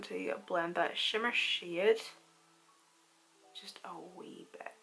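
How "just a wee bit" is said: soft whispered aside; whispering. Real, not acted. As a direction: breathe it under your breath. Whispered aside while blending.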